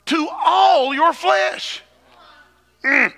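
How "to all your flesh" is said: all your flesh shouted high and slow. Acted. Shouted preaching climax.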